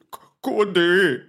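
stammered, tearful question; tearful bear. A narrator's reading. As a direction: ask with a loud catch on the first sound. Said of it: "підвів заплакані очі ведмедик".

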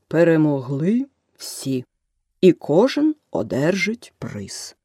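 повільно, з вагомими паузами; виголоси як вирок судді, розтягуючи слова; урочисте виголошення. A narrator's reading. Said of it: "Нарешті дронт виголосив". (повільно й урочисто проголошує)

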